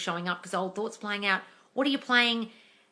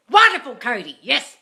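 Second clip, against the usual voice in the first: excited cheer; 4 semitones higher, 13 dB louder than usual. Real, not acted. Cheering a viewer's comment.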